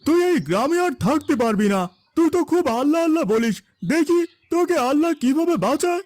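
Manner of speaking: taunting villager. Acted. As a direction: jeer at him, daring God to save him. Villager taunts the bound old man.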